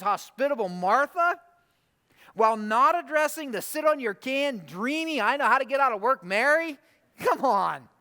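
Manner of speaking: high-pitched, disbelieving challenge; mock-indignant objection building through the list; incredulous protest. Reader challenging Jesus over reprimanding Martha, incredulous. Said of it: "I read this story and I'm like".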